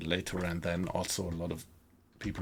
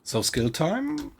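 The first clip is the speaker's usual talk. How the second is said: calls the chatter's name up high, sing-song; 14 semitones higher, 6 dB louder, slower than usual. Real, not acted. Streamer greets chatter Skill Time with a high sing-song call. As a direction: greet the viewer with a bright high lilt.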